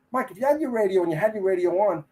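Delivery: a mildly exasperated boss; lightly voiced annoyed officer; irritated lieutenant. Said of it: "tone of voice".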